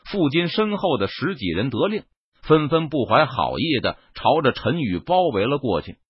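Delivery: ordinary delivery, nothing marked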